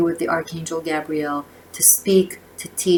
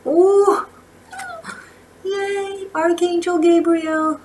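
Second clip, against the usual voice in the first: high, drawn-out cheer of delight; thrilled squeal on seeing the card; delighted excitement; 13 semitones higher, 5 dB louder, much slower than usual. Real, not acted. Delighted at drawing a favourite card.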